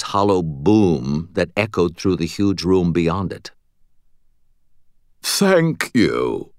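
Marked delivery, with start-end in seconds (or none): 5.2-6.5 snooty, obnoxious British nobleman; obnoxious British accent. A narrator's reading. Butters playing the hated nobleman, obnoxious British accent. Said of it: "the absolutely obnoxious British accent".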